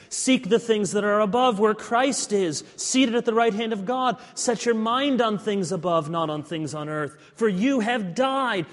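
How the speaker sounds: loud, ringing Bible reading; forceful scripture reading. Acted. Pastor reads scripture aloud with force. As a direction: proclaim the verses to the congregation.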